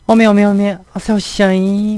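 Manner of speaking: high, loud Jeolla-dialect greeting. Retold or imitated. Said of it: "막 이런 거". (높고 큰 전라도 사투리 인사)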